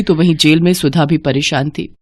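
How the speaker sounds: ordinary delivery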